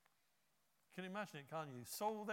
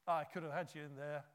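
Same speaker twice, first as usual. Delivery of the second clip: louder, higher scornful jeer; mock the king like a braggart; hammed-up sneering gloat; mock taunting; 5 semitones higher, 5 dB louder than usual. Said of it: "He doesn't say".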